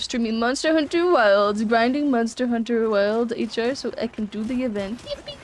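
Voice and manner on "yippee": excited cheer. Real, not acted. Cheering over game plan.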